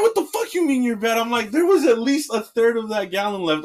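high-pitched indignant rant; retold outrage. Worker rejecting supervisor's apology over the milk, outraged.

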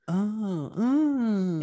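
high hammed-up porn moan; mock the extra acting; overacting moan. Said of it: "the extra acting".

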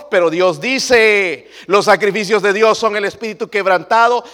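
high, loud, fervent sermon; preaching at full force. Acted. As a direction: thunder it at the congregation.

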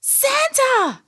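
very high, drawn-out character squeal; delighted cry. A narrator's reading. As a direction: call out in childlike delight. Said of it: "Billy shouted in delight".

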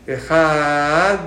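Ejat, relaxed and not squeezed; Het and Dalet without squeezing. Shown as a demonstration. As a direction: say Ejat loosely, no hard push on the Het. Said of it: "sin apretar".

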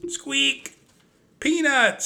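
put-on a little girl thrilled to see her pet; high delighted cry. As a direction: squeal the mouse's name up high and bright with joy. Annie greets the mouse excitedly.

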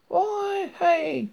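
high, loud hailing cry; frantic high-pitched hollering; calling out. A narrator's reading. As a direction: call out wildly, pitched right up. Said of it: "he crept".

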